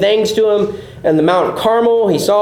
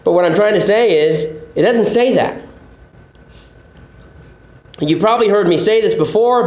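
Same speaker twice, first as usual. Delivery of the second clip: ordinary delivery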